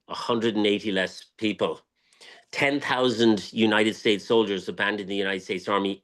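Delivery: ordinary delivery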